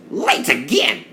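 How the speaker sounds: high, cross snap of a complaint; a cross engine fed up with waiting. Acted. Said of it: "This made James cross".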